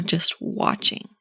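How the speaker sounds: calm whispered invitation to simply watch; soft slow whisper. Shown as a demonstration. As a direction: whisper "just watching" slowly and softly. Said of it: "instead of being all in the drama".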